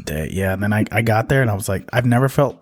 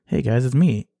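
normal talking voice

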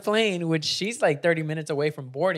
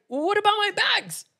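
panicked protest; 12 semitones higher than usual. Retold or imitated. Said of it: "she's like".

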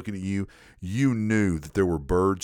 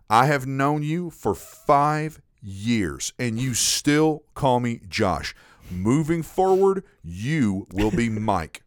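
slow, every word weighed; slow deliberate decree; much slower than usual. Retold or imitated. Slow mock decree to the coworker who forgets names. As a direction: lay it down word by word.

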